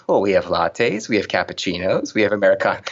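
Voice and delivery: same lilting list, each drink tipped upward; barista spiel. Retold or imitated. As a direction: imitate a barista reciting the menu yet again. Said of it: "The barista goes into the spiel".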